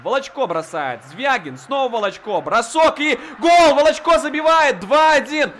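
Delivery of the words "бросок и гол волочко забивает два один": loud, high shout of the goal; excited goal call. Real, not acted. Goal scored, commentator shouts. (взволнованный крик «гол»)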